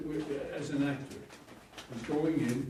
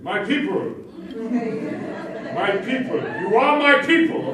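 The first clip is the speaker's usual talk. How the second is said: loud, high commanding call to the crowd; booming proclamation; 10 semitones higher, 17 dB louder than usual. Retold or imitated. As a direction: boom it out like an orator taking the stage. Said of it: "and he said".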